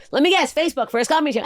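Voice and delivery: high-pitched exasperated call-out; mock guessing. Retells mocking heckler on stage.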